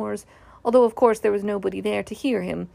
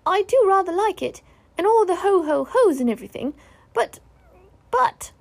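high, loud little creature, faltering; squeaky small animal hesitating; Piglet; 9 semitones higher, 5 dB louder than usual. Acted. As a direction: start keen up high, then stall. Piglet hesitates over the hum.

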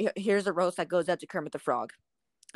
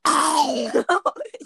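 Snoopy; 9 semitones higher than usual. Acted. Friend showing the noise a cartoon dog makes, high wail. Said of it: "This is my impression of Snoopy".

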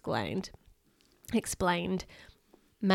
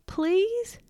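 a much higher pleading please; begging please; 11 semitones higher than usual. Acted. Bill begging Mazu for the scale, high pleading. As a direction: plead like a small child. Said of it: "begged Bill".

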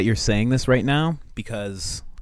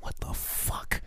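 stunned whisper; 8 dB quieter, much slower than usual. Retold or imitated. Imagined MLK hears the year.

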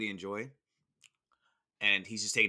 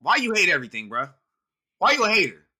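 high, loud playful call-out; loud teasing; 8 semitones higher, 11 dB louder than usual. Real, not acted. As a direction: tease him loudly for hating. Teasing friend over his rant.

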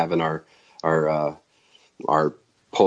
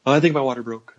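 higher-pitched quote of her announcement; imitated wife; 7 semitones higher, faster than usual. Wife telling husband labor has started, imitated in a higher voice.

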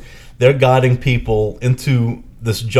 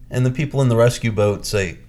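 ordinary delivery

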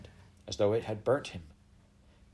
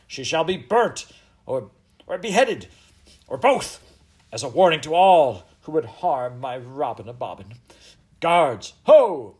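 loud, high royal tirade building to a shout; furious threat; 5 semitones higher, 11 dB louder than usual. Acted. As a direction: pronounce doom, then bellow for guards. Queen threatens death, summons guards.

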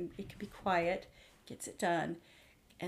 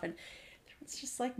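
usual speaking voice

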